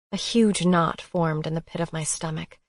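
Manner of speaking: ordinary delivery, nothing marked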